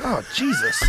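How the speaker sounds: mock shock, high and breaking into laughter; startled high exclaim. Bullied boy hearing tiny kid's threat, high startled laugh. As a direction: squeak it out in disbelief.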